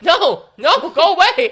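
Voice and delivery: whiny loud push-away; mock protest. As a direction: shoo him off in mock despair. Retold protest to late visitor.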